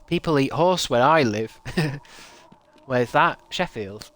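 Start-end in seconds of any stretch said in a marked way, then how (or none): none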